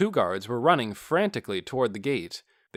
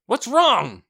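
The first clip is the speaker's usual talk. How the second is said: alarmed call up; 12 semitones higher, 9 dB louder than usual. A narrator's reading. Guard calls up the stairs.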